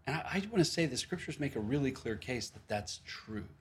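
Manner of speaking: ordinary delivery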